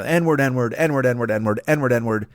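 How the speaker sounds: ordinary talk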